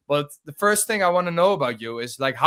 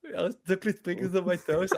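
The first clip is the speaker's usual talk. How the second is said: put-on Dutch accent; 3 semitones higher, 5 dB quieter than usual. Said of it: "if it was Dutch, you have to have the lisp".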